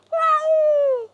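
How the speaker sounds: very high, long yowl; high imitated cat wail; cat caterwaul. A narrator's reading. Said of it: "The cat caterwauled".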